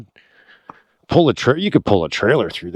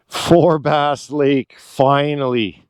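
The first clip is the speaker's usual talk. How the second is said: very slow, drawn-out declaration, slightly higher; savouring arrival; 3 semitones higher, much slower than usual. Real, not acted. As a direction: announce the long-awaited name, stretching each word. Savours naming the reached destination.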